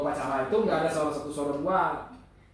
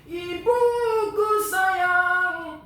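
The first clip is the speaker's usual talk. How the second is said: high, slow and louder, agak keras; powerful outer voice; 14 semitones higher, 8 dB louder, much slower than usual. Shown as a demonstration. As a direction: push it out from the chest. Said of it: "Tapi kalau tenor".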